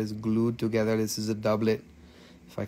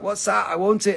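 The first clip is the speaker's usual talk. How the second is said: mock eager buyers; 6 semitones higher than usual. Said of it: "people were like".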